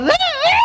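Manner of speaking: high, loud mock yell; hammed-up parental yell. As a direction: blast the sound like a shouting dad. Imitating the father's yelling.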